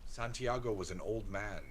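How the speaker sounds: ordinary delivery, nothing marked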